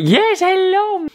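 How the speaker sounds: high sing-song greeting to the dog. Real, not acted.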